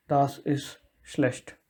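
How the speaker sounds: slow, careful Das ist schlecht; slow demo. Shown as a demonstration. Teacher ends the example by slowly modelling Das ist schlecht. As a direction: spell it out slowly for learners. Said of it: "It should not happen like that".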